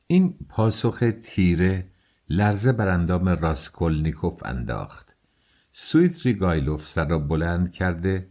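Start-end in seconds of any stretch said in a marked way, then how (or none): none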